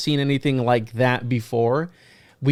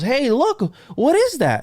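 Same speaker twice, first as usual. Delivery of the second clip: much higher, mock child calling out; imitating excited son; 11 semitones higher, slower than usual. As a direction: play the little son pointing at the sky, pitched way up. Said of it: "My son goes".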